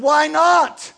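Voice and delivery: high, loud puzzled ask; surprised question. Acted. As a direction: counselor asks husband, puzzled and surprised. Counselor asking husband.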